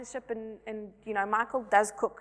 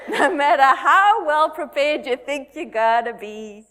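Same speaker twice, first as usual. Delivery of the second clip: slow, loud, laughing through the words; 2 semitones higher, 9 dB louder, slower than usual. Real, not acted. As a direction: laugh as you warn the mothers. Laughing at new mothers.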